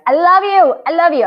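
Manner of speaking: loud, high "I love you"; loud gushing sign-off love. Real, not acted. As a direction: beam it out to them.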